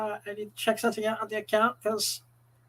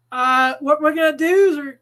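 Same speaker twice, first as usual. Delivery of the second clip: usual speaking voice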